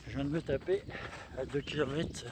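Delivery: panting after a climb; winded while running; out of breath. Real, not acted. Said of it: "je suis un peu essoufflé".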